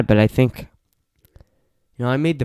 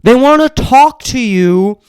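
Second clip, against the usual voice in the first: high, loud, indignant tirade; higher and louder exasperated complaining; heated rant; 11 semitones higher, 4 dB louder than usual. Real, not acted. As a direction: keep venting, raised and fired up. Heated rant about guests.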